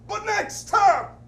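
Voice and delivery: high, loud and slow in a put-on voice; pointed punchline. Host's closing demand to the governor, high and drawn out. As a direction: drive home the demand.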